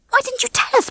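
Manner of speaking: high-pitched, concerned question; dismayed. A narrator's reading. Ginger questions panicking Babs, dismayed. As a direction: ask in alarmed dismay.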